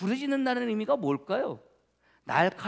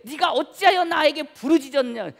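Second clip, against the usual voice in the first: loud, raised rebuke as God; reproachful God; 5 semitones higher, 7 dB louder than usual. Retold or imitated. God answers Moses over the people's complaint, loud reproach. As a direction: imitate God challenging a complaint, pushed loud and high. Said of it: "나에게 불평하느냐".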